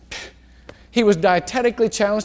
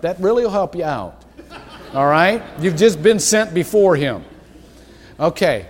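ordinary delivery

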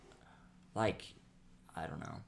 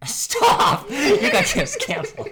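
higher, louder words through laughter; playful laughing accusation; 15 dB louder, much slower than usual. Real, not acted. Laughing at joke.

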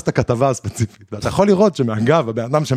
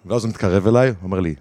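usual speaking voice

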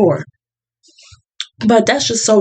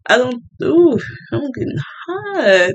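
feeling overheated; 5 semitones higher than usual. Real, not acted. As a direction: let the heat get to you, a pained ooh. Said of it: "I'm getting a little hot".

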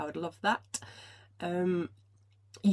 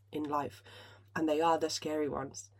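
ordinary delivery

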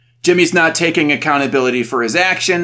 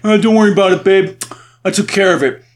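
higher, mock-breezy brush-off; put-on don't-you-fret voice; glib reassurance; 3 semitones higher, 3 dB louder, faster than usual. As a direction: imitate a husband waving away worry. Imitates husband brushing off worry.

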